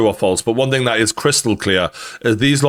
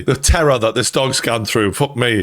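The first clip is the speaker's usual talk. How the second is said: ordinary delivery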